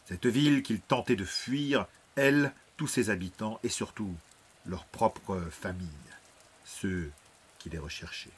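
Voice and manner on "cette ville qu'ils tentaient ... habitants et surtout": ordinary delivery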